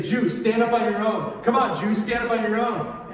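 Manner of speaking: loud, taunting order; bully a beaten prisoner with mocking commands; jeering guards. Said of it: "they're laughing at him. They say".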